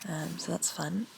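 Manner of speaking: ordinary talk, nothing marked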